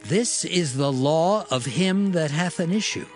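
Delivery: usual speaking voice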